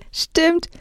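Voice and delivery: high, giggly agreement; amused, laughing. A narrator's reading. Girl agreeing after a retort, high and amused. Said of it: "Das Mädchen lachte".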